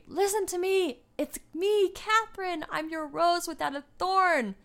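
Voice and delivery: high, loud cry to be heard; acted desperate scream. Catherine screaming to King Henry in the chapel, high, loud plea. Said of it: "She is screaming to Henry".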